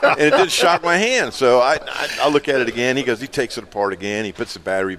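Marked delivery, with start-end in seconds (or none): 0.0-1.3 laughing. Real, not acted. Laughing while retelling shock.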